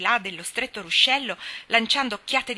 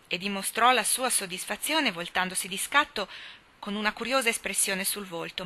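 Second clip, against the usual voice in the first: ordinary delivery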